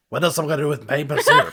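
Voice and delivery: fast, laughing question; real laugh mid-line. Real, not acted. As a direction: laugh it out quickly. Laughing during the joke.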